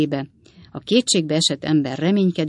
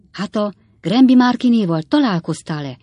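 plain reading voice

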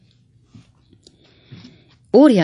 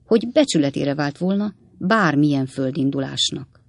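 ordinary delivery

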